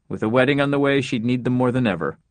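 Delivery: plain reading voice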